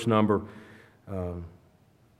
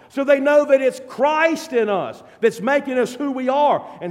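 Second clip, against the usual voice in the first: high, loud, impassioned preaching; preaching at full force; 7 semitones higher, 4 dB louder than usual. Acted. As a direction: drive it home to the congregation. Preacher building to a climax.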